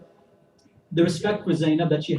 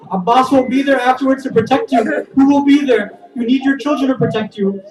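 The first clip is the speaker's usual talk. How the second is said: raised, higher voice, near tears; tearful pleading; 5 semitones higher, 5 dB louder than usual. Acted. Hussein begs his sister to keep her sons, tearful. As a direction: plead with her, voice breaking upward. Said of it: "Hussein begins to cry".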